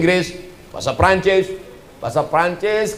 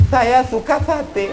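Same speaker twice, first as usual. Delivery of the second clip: laughing through saya suka sate; amused little shopper line; mock customer; 7 semitones higher, slower than usual. Preacher imitates an Indonesian buyer, laughing. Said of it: "Mana ada orang Indonesia beli".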